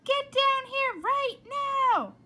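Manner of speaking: very high, very loud shout. Acted. Mother shouting for Flora from downstairs. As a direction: yell her name across the house.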